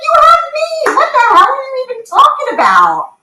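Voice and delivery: hammed-up retold anger at the doctor; incredulous high-pitched protest; mock outraged shout. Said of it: "I'm like".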